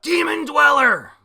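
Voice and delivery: very loud, high attack name; attack yell. Retold or imitated. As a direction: scream the move like an anime hero. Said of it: "Asta yells out".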